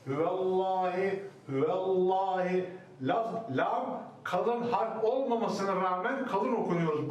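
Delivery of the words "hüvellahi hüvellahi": "Hüvellahi" with thin V and thick lam, slowly; correct demo. Shown as a demonstration. Teacher showing students "hüvellahi" slowly, thin ve. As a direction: say "hüvellahi" slow, thin ve then full lam. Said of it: "Yani şöyle".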